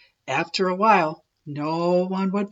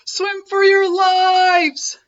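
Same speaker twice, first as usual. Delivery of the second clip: very high, loud, slow panic; panicked shout; 13 semitones higher, 7 dB louder, slower than usual. A narrator's reading. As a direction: yell for everyone to flee. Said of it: "cried Joey Mackerel".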